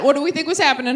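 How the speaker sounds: normal talking voice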